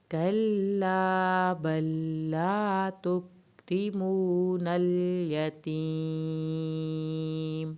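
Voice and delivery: slow, drawn-out melodic tajweed recitation; Quran recitation. Shown as a demonstration. Teacher reciting the verse for students, slow melodic recitation. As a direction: chant the verse slowly, stretching every long vowel.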